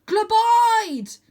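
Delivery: high, loud command shout. A narrator's reading. Arthur shouting the lift-off word to Vlad. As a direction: bark the command to rear up. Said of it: "I shout".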